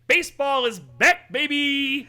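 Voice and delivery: loud, high hype cry; excited. Real, not acted. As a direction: hype up the return of baseball. Announcing opening day.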